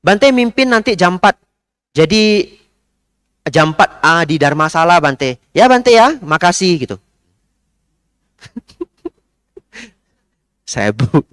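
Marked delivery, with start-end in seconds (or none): 0.0-6.7 high, loud order from a pushy lady; imitating a bossy lady, high and loud; commanding lady. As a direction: bark out the order and thank him at once. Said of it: "Ibu ini bisa tiba-tiba seperti ini".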